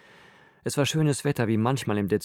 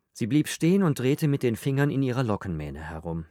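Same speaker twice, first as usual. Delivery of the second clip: ordinary delivery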